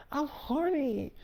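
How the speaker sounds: high, soft, slow and sultry. Retold or imitated. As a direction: purr it softly, slowly. Wife coming on to him.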